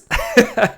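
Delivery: short high nervous laugh. Real, not acted. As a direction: laugh off the jitters. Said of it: "why I'm nervous".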